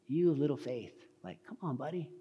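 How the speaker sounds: soft, kindly chiding; a hushed, friendly nudge; gentle Jesus. Acted. Jesus encouraging his buddy Peter, gentle. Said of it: "that's how Jesus would say it".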